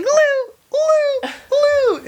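very high-pitched, loud imitated yell; high mock yelling, each cry drawn out; Danny DeVito yelling. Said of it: "Danny DeVito starts yelling".

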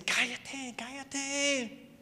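a high, lightly acted hush. Crowd telling the beggar to be quiet, hushing.

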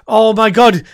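loud burst of glee at the idea; excited outburst. Real, not acted. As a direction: blurt it out, thrilled. Sudden excited outburst about trolling.

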